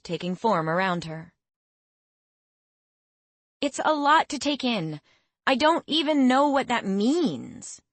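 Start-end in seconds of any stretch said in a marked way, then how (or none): 3.6-7.8 higher, frustrated complaint; exasperated. A narrator's reading. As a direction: protest in exasperation. Girl frustrated with tutor.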